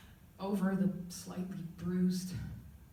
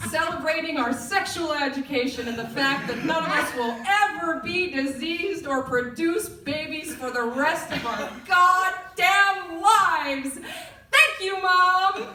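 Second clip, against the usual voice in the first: building to a loud, high sarcastic cheer; mock triumphant shout; 8 semitones higher, 6 dB louder, slower than usual. Daughter mockingly thanking mom for sex ed, loud and high. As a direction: swell into a hammed-up victory yell.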